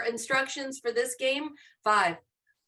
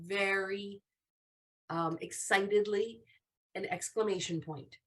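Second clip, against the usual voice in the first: ordinary talk, nothing marked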